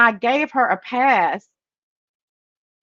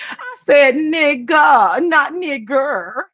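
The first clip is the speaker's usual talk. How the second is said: mocking Misty; 5 semitones higher, much slower than usual. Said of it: "Misty, goes".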